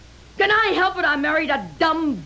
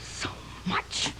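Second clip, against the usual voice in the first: breathless hateful whisper; 12 dB quieter than usual. Acted. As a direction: whisper it with venom. Wife says she hates him.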